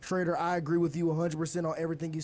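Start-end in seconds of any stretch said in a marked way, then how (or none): none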